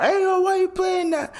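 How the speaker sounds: very high, imitated hollering at someone; mock angry yell. Rapper goes off at the DJ over a song, mock angry yell. Acting [name] going off at DJ.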